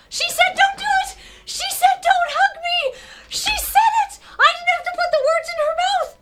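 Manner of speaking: very high, loud squealing shout; excited screaming. Real, not acted. Streamer cheering that she said no, excited screaming. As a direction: shriek it in disbelief and triumph. Screaming in delight at character's refusal.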